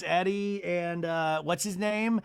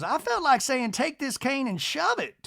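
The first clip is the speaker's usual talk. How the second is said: high put-on matriarch, slowing at the end; Miss Patricia; 9 semitones higher than usual. Miss Patricia on returning the cane, high mock disdain. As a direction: mimic her haughtily, pitched up. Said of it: "Miss Patricia goes".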